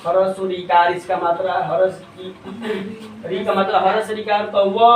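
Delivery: ordinary talk, nothing marked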